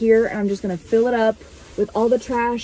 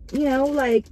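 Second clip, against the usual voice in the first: ordinary talk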